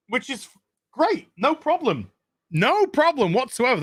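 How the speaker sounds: high, mock-cheery no problem; sarcastic. Vlogger to viewers, sarcastic mock cheer. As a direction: pretend to be totally fine with it.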